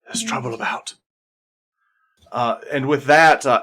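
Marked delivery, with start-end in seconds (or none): 0.1-0.9 hushed and hurried, a soft shh first; hushed warning. Acted. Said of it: "she's kinda like".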